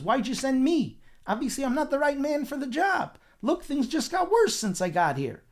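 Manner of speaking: high, loud protest; exasperated complaint. Retold or imitated. Moshe returns to Hashem complaining, exasperated.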